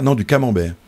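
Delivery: correcting, "camembert" accented on its opening "ca"; "camembert" with the first syllable punched, ending falling; stress on first syllable. Shown as a demonstration. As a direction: hit "ca" in "camembert" to correct a mishearing. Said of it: "en accentuant le début".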